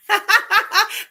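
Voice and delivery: high, loud burst of laughter. Real, not acted. Host amused by a viewer's comment, loud laugh. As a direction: laugh out at the joke.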